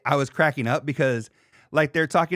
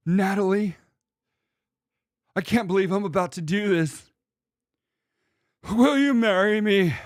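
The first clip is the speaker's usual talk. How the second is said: lightly hammed-up proposal from the next room; a hint of a teary suitor proposing; mock proposal; 5 semitones higher than usual. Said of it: "And they're like".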